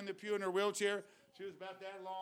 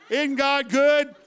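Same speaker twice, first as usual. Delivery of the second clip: much louder, shouting the praise call; rally the church to clap and praise; preaching at full force; 3 semitones higher, 11 dB louder than usual. Acted. Preacher calling the congregation to praise God, loud shouting.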